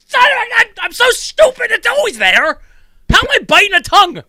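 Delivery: high, loud frustrated self-scolding; frustrated outburst. Retold or imitated. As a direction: scold yourself, exasperated. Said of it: "'Cause it's like".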